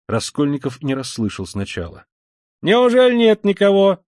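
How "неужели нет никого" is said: high, loud, cheery call; ringing cheerful shout. A narrator's reading. Newcomer to the man at the bell, ringing cheerful shout. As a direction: call out brightly and merrily. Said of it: "звонко и весело закричал подошедший".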